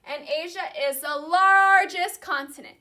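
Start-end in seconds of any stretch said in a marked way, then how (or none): 1.2-2.8 "largest" loud and drawn out; stress on largest. Real, not acted. Teacher introduces Asia to class, "largest" stretched and loud. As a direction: stretch "largest" and hit it hard.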